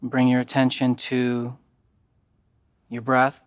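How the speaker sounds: calm, even yoga nidra guidance with long pauses; guided meditation. Acted.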